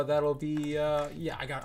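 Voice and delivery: ordinary talk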